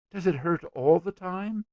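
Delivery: put-on high, concerned little girl; anxious little girl. A narrator's reading. Little girl asking about his pain, anxious.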